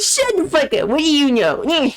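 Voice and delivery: dismissive high cartoon-kid voice; Eric Cartman impression. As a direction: grandpa telling his granddaughter off, bratty cartoon voice. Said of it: "I do, like, an Eric Cartman impression".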